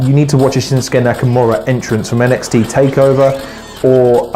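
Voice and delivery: ordinary talk, nothing marked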